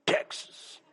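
"Texas" breathed out soft and unvoiced, full of pride; proud breathy Texas. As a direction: savor "Texas" like a secret treasure. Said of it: "But y'all always say".

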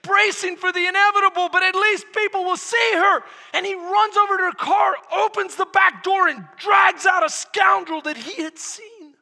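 loud, high, driving narration; urgent storytelling. Acted. Preacher reveals the scoundrel in her car, urgent shouted storytelling. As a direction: shout the climax of the tale.

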